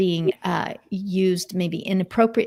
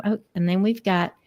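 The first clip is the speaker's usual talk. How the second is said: usual speaking voice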